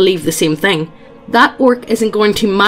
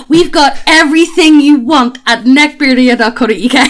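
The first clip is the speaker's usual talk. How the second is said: high, slow, louder cheesy ad read; 4 semitones higher, 4 dB louder than usual. Acted. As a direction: sell it like a cheesy advert. Hammed-up sponsor ad read.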